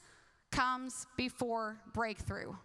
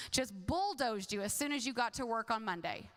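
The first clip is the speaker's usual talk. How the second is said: usual speaking voice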